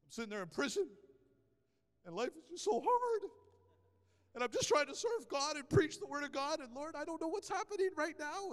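mock-sorry moan, not overdone; lightly voiced self-pity. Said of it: "tempted to have a pity party".

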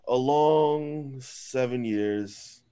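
slow, drawn-out tragic announcement; mock solemn. Mock-solemn announcement of celebrity divorce.

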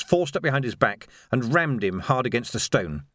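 plain reading voice

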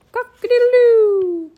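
loud high rooster cock-a-doodle-doo; rooster crow. A narrator's reading. Rooster crowing in story.

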